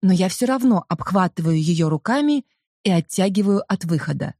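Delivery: ordinary delivery, nothing marked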